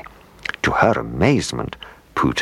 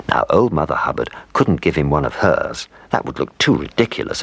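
plain reading voice

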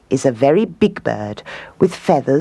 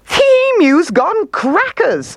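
very high, gleeful bird voice; high-pitched cackling bird character; laughing kookaburra. A narrator's reading. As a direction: jeer like a kookaburra in hysterics. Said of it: "couldn't stop laughing".

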